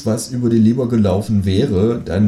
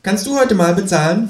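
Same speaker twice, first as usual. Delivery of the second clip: high-pitched creature voice, a little louder; Känguru; 10 semitones higher, 4 dB louder than usual. A narrator's reading. Kangaroo asks companion to pay after the meal, high kangaroo voice. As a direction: ask it high, pitch jumping up. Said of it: "fragt das Känguru nach dem Essen".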